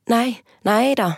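high startled cry; shouting princess. Acted. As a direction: cry out in alarm at a stranger. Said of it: "ropte hun".

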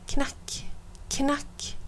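soft unvoiced knock knock; hushed knocking sound; whispered knock. A narrator's reading. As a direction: breathe the knocks like a tap on glass. Knocking quietly on window.

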